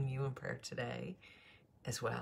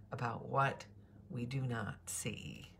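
normal talking voice

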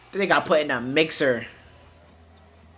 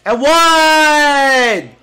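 big high yell of "And one!", much louder; and-one shout; 10 semitones higher, 8 dB louder than usual. Real, not acted. Streamer yells at the TV as the shot falls, loud high and-one call. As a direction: hype the and-one like a courtside announcer. Calling a basketball and-one play.

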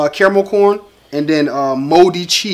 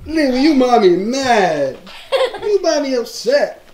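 laughing tease; 9 semitones higher than usual. Real, not acted. Teasing with laughter.

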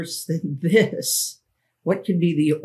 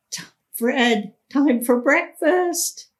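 much higher, slow sing-song call; calling from downstairs; 11 semitones higher, 3 dB louder, slower than usual. Retold or imitated. Minnie calling Fred down to breakfast, high and sing-song. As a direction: call up the stairs like a cheerful friend. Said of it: "Minnie calling from the bottom of the stairs".

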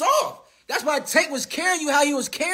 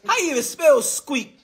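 usual speaking voice

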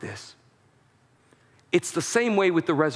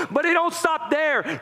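rising preacher's cry; 11 semitones higher than usual. Acted. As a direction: lift it high and push it out like a climax. Sermon builds to climax.

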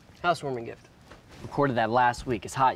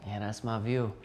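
normal talking voice

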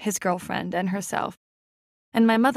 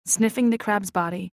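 ordinary delivery, nothing marked

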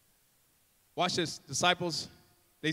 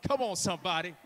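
ordinary talk

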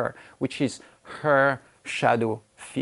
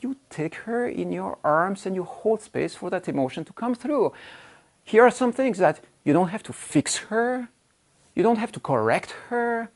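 usual speaking voice

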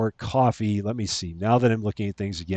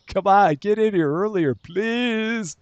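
pleading; 11 semitones higher, 3 dB louder, slower than usual. Pretend. Pleading with imaginary customers.

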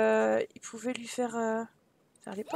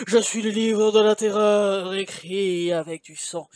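sinister book voice; 7 semitones lower, 5 dB louder than usual. A narrator's reading. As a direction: boom it out, sinking into a very low evil laugh. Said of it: "une voix semblable au cri de mille corbeaux affamés".

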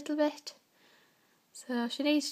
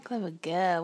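usual speaking voice

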